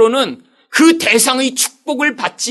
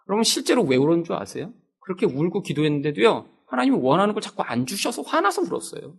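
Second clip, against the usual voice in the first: normal talking voice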